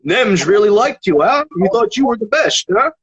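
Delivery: high-pitched mock Dutch accent. Dutch judge passing on praise to the rapper, Dutch accent.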